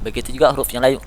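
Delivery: normal talking voice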